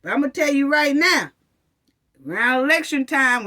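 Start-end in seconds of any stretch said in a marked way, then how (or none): none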